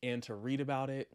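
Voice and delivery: usual speaking voice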